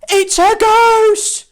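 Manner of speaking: high, loud, slow terrified scream; screaming in terror. Acted. All the disciples scream at the figure on the water, terrified. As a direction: shriek it like you've seen a ghost. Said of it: "screamed in terror".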